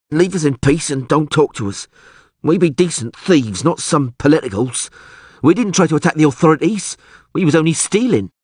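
high, protesting commoner's voice; high-pitched indignant jailbird; petty thief, defensive. Acted. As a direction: plead to be left alone as a small-time crook. Thief character voice.

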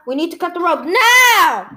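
loud desperate cry; a frantic cry to act now; urgent shout. Acted. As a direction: shout over the danger.

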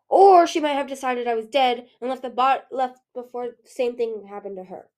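bitter, starting loud and easing off; resentful speculation, hit hard at first; understated bitterness. A narrator's reading. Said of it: "Winter said bitterly".

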